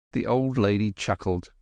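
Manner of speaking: ordinary delivery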